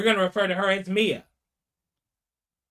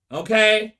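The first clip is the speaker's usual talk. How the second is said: ordinary talk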